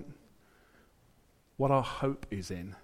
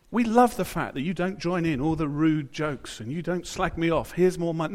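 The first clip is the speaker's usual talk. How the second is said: high, loud, over-sweet boss voice building louder; mock gushing boss; 7 semitones higher, 7 dB louder than usual. Preacher mocks a boss showering praise, gushing loud and high. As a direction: ham up a boss who adores you, louder and louder.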